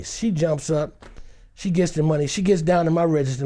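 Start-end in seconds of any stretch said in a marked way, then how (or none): none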